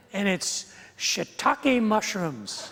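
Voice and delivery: "shiitake" drawn out slowly with a laugh; dragged-out shiitake. Shown as a demonstration. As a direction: stretch the "shii" of "shiitake" long and slow. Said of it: "gotta drag out the first part".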